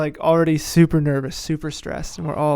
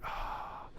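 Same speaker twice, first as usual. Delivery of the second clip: deflated oh; 20 dB quieter than usual. Retold or imitated. Shot made over him.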